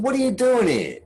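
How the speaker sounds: a light retold burst of disbelief; disbelieving question. I couldn't believe it.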